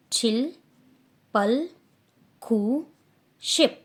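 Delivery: loud, deliberate Korean counting from zero to ten; careful Korean numbers. Shown as a demonstration. Teacher models Korean numbers for Hindi-speaking students, loud and precise. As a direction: spell out each Korean number clearly and loudly. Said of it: "pronunciation मैं कैसे कर रही हूँ उस पर ध्यान देना".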